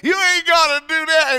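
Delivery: high, loud mock-weepy pastor; mock tearful preacher. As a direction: ham up a teary humble protest. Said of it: "went into that sobbing".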